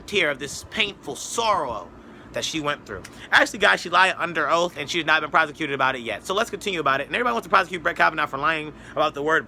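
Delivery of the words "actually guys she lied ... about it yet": ordinary talk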